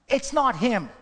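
high, loud insistence on NOT; firm call. Retold or imitated. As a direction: cut them off loudly, stressing not. Girl stops the men.

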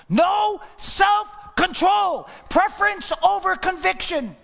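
high, slow shout, each word hammered; thundering preacher. Real, not acted. Preacher condemning the Esau appetite, thundering shout. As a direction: preach it at full force.

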